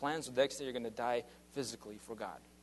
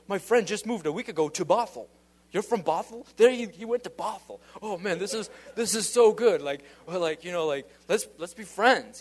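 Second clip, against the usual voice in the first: loud, high, gushing retold excitement; excited retold pastor; 7 semitones higher, 10 dB louder than usual. The local pastor gushing to the Seattle visitor, loud and high. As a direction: gush like a thrilled new friend.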